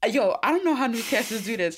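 normal talking voice

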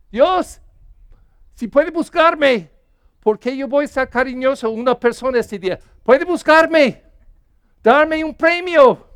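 a self-righteous believer demanding a reward; bargaining believer. Retold or imitated. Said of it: "si pensamos que estamos cambiando la mente de Dios".

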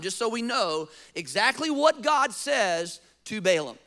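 ordinary talk

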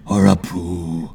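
very low, slow superhero voice; deep, deadpan hero voice; Batman. Acted. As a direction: drop deep and draw it out with mock gravity. Batman adds a silly guess.

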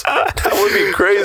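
high, slow, put-on squeak; silly high put-on voice, slowed down; mock high voice. Joking mock reaction.

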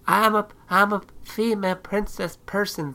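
high, put-on princess voice; mocking princess voice. Mimicking someone tortured in their head, mocking princess voice.

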